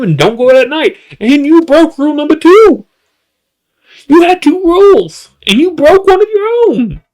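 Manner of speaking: high-pitched, incredulous comic rant; mock outrage. Host scolds bayou man for breaking his rule, mock outrage. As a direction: climb high in exasperated disbelief.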